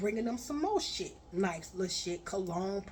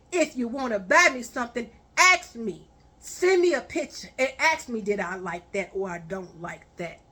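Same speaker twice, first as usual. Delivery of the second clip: louder and faster, scolding; angry rant; 7 dB louder than usual. Retold or imitated. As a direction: tear into him, raising your voice. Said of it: "I say".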